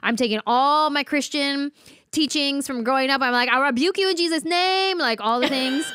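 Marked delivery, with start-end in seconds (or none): 3.5-5.0 high-pitched hammed-up exorcist cry; mock rebuking. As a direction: cast it out like a panicked believer, pitched high. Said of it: "I start rebuking everything".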